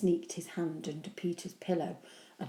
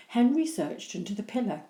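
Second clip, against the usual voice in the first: usual speaking voice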